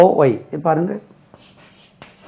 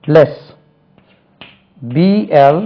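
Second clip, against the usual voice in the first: normal talking voice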